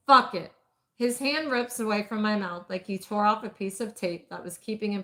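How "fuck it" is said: sudden loud resolve; forceful outburst. A narrator's reading. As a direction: blurt it with force. Bo breaks, decisive outburst.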